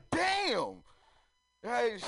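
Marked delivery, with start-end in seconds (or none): 0.1-0.8 impressed damn. Retold or imitated. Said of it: "I was like".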